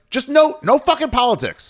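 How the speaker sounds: high-pitched comic exasperation; squeaky, pleading protest; exasperated refusal. Retold or imitated. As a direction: whine it high, fed up. Acts out refusing political videos.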